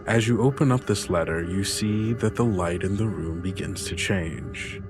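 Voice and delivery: usual speaking voice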